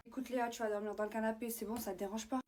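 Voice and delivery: unvoiced whisper, hushed so nobody hears. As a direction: breathe the words without voice. Whispered aside at night.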